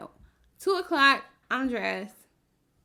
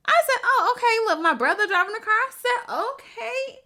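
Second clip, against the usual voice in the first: high, loud retold excitement with a laugh; retelling the surprise, high and laughing; excited surprise; 12 semitones higher, 7 dB louder than usual. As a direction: squeal it like the surprise just hit.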